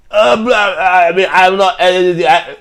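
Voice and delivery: mocking, high, loud babble; Tony Romo. Host mocks commentator failing to answer questions, loud spluttering gibberish. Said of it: "saying gibberish".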